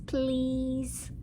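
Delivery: ordinary talk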